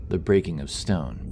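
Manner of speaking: plain reading voice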